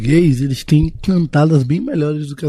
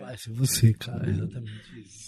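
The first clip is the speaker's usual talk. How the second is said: soft; 6 semitones lower, 14 dB quieter than usual. Retold or imitated. Echoes line in soft voice.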